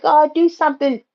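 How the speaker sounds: high, loud plea to God; pleading prayer. Retold or imitated. Praying for family, high urgent plea. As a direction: beg God for action. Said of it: "I be like".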